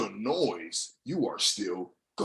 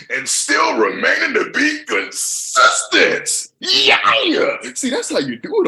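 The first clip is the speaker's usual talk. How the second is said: much higher, loud, slow, voice cracking with emotion; passionate yell; 11 dB louder than usual. Real, not acted. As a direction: cry it out with full passion. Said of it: "if you think I'm yelling at you".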